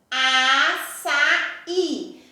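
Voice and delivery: "açaí" drawn out syllable by syllable, stress on the final "í"; sílaba por sílaba. Shown as a demonstration. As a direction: sound out "açaí" slowly, leaning on the last "í". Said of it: "Toda vez que a minha sílaba tônica for a última". ("açaí" separando as sílabas, com o "í" final forte)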